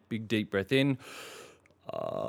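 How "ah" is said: long, smooth yawning "ah" gliding up from very low; big yawn slide. Shown as a demonstration. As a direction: glide through a big open yawn. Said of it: "let's just have a big yawn".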